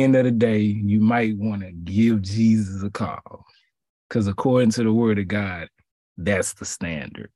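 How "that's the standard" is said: dropped low and soft, almost to himself. Acted. Preacher repeating the standard, quiet and low. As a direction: let it sink, low and soft.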